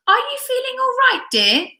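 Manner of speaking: a husband's fluttery concern; concerned high question. Acted. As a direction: ask fussily, up high. Husband asks ill wife.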